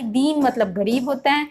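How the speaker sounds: normal talking voice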